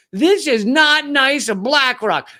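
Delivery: prim Southern scolding, slowed down; Southern accent. Retold or imitated. Comedian mocking a TV host's complaint in a Southern accent. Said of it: "Tough to do that Southern accent".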